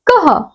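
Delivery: teacher sounds out कह slowly for the class; visarga sound demo. Shown as a demonstration. Teacher showing students how visarga sounds, clear demo. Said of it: "इसका उच्चारण किस प्रकार से किया जाएगा".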